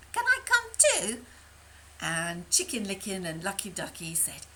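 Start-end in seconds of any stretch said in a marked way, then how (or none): 0.1-1.3 high, louder eager asking; eager hen voice. Acted. Henny Penny to the others, high eager plea. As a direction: ask hopefully like a hen.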